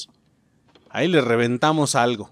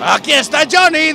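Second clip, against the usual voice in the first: Johnny quote; 12 semitones higher, 8 dB louder than usual. Retold or imitated. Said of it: "Dice ese cabrón".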